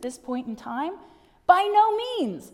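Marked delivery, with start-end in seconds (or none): 1.5-2.5 higher, louder and slow: by no means; slow, ringing emphatic no; emphatic denial. Acted. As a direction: thunder Paul's denial. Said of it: "says Paul".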